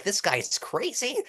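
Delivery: high-pitched disbelief; incredulous. Shown as a demonstration. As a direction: pitch it up, as if he's crazy. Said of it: "If you wanna sound incredulous".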